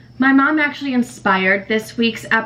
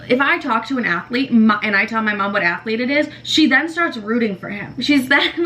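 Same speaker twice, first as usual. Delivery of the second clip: ordinary talk, nothing marked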